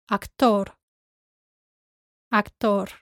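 Actor as ak-TOR, each time; stress on O. Shown as a demonstration. Teacher models for learners, Actor stressed on the O. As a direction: punch the O in Actor, Spanish style. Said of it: "stress goes on the O".

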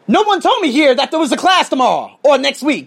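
high, indignant retold complaint; indignant challenge. Teacher confronting staff about an unannounced class, indignant.

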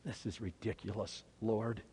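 very soft, low muttered thought; hushed, low private disbelief; thinking it. Acted. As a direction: say it under your breath, to yourself. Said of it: "hear Peter thinking those words".

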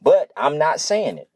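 ordinary delivery

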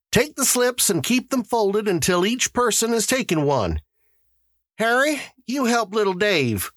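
high-pitched official addressing the crowd; high character voice for announcements; Mister Summers, the lottery official. A narrator's reading. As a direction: voice an organizer moving everyone along.

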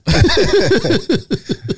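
high burst of laughter; a real high laugh at his own story. Real, not acted. Laughs after telling quote.

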